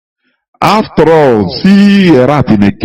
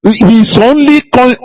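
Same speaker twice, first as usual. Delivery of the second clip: ordinary delivery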